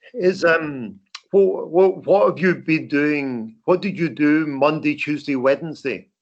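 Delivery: ordinary delivery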